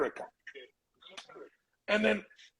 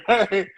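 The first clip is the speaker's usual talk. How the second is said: loud, high burst of real laughter; big high laugh; 6 semitones higher, 8 dB louder than usual. Real, not acted. Cracking up after doing Shrek for the interviewers, a loud, high laugh. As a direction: crack up at your own joke.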